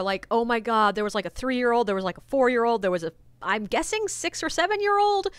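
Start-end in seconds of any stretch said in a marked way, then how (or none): none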